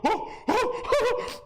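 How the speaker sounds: high laughing oh oh oh; mocking squeals. Mocking the arrested girlfriend, high laughing squeals. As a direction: squeal it back at her, laughing.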